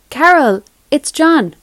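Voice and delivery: high, loud call down a bad line. A narrator's reading. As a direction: make yourself heard over a crackling connection. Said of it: "said a crackling voice".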